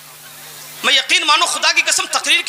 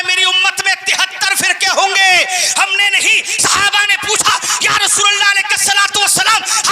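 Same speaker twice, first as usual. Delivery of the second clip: raised, driving sermon oratory; fiery preaching; 8 semitones higher than usual. Real, not acted. Preacher challenging the congregation with the Prophet's saying, fiery preaching. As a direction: hammer out the list high and ringing.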